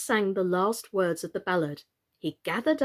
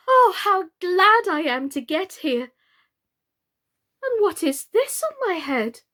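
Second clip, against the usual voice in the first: higher, louder, joy turning to dismay; dismayed exclaim; 9 semitones higher, 8 dB louder than usual. Acted. Alice finds something on her head, exclaiming. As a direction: glad then startled. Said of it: "she exclaimed in a tone of dismay".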